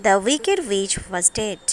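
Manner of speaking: ordinary delivery, nothing marked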